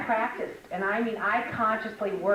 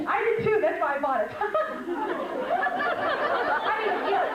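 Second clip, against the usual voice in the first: high, laughing through the words; 14 semitones higher, 4 dB louder than usual. Real, not acted. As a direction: giggle while saying it. Laughing at dress joke.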